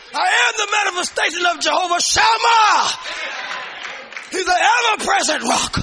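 loud, high preaching with a shouted build; full-force preaching. Real, not acted. Preacher urging the church not to back down, shouting. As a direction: drive the congregation, don't let them back down.

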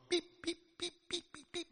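high squeaky bird beeps; little eagle peep. Acted. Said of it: "He's getting my Pete voice".

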